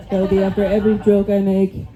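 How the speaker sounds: normal talking voice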